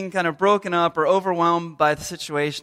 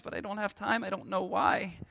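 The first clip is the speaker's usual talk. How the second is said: mock despair, softer and sinking; hushed, hammed-up parental despair; broken up; 10 dB quieter than usual. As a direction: trail off quietly in feigned defeat. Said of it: "now I'm broken up and emotional".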